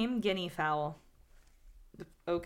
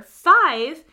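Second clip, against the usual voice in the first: loud, higher cry of five; fatal five; 7 semitones higher, 15 dB louder than usual. Acted. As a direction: hit five hard and high. Counting victim's fatal word.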